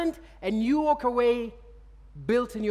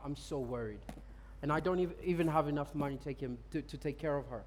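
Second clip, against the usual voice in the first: worried quiet confession; 7 semitones lower, 10 dB quieter than usual. Retold or imitated. Worried man explains sick girlfriend.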